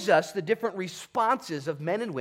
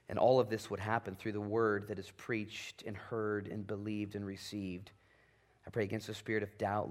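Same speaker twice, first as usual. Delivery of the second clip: softer, lower, intimate prayer voice; 6 semitones lower, 5 dB quieter than usual. Acted. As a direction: pray quietly with the congregation. Leads closing prayer.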